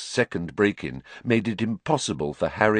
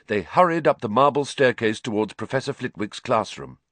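ordinary talk

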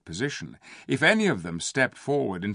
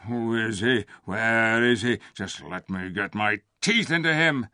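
growling beasts. A narrator's reading. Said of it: "came the growls".

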